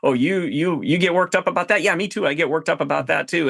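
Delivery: high, eager people-pleaser echo; mock mirroring client. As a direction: parrot everything back, keen to please. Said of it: "mirroring too much and just like".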